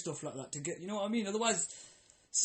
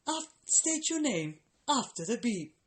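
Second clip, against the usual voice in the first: slow, higher robotic recorded prompt; automated voicemail voice; 6 semitones higher, slower than usual. Retold or imitated. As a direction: mimic the machine's flat instruction.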